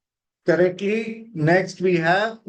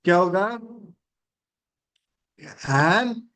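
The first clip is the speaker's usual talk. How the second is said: ordinary delivery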